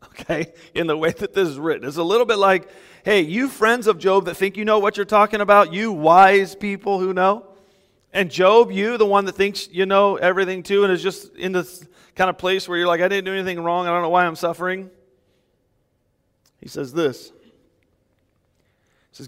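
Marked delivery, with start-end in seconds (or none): 3.0-14.9 mocking the know-it-alls, a bit higher and louder; sarcastic paraphrase. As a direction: needle the friends with mild sarcasm. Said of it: "a little bit of sarcasm there".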